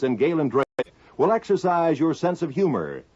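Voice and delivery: ordinary talk, nothing marked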